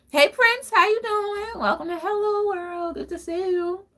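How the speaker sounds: high, loud bright hello; high-pitched welcome to a new viewer; excited greeting. Real, not acted. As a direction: call out a happy welcome. Greets a viewer joining live.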